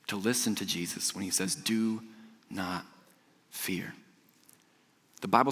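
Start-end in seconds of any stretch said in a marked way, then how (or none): 1.5-3.9 hushed command. Acted. Jesus' command in storm.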